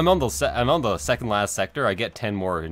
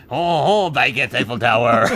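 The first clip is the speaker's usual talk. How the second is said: hammed-up French accent, louder; mock French accent; 7 dB louder than usual. Mocking what the French say, in a hammy French accent. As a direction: ham it up like a cartoon Frenchman. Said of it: "it's like we say in, uh, France".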